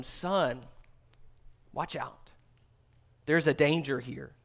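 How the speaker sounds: soft, hushed fatherly plea; tender warning. Acted. God pleading with his son Cain, softly and tenderly. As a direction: warn him gently, like a worried father.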